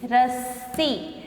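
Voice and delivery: रस्सी drawn out slowly and softly; teacher stretches रस्सी for pupils; slow model word. Shown as a demonstration. Teacher to pupils, slow soft model word. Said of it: "रस्सी हम कैसे लिखेंगे".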